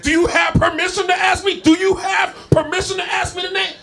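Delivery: cornered cab driver shouting; flustered shout. Retold or imitated. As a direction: bark it back, caught out. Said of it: "And he crumbled, said".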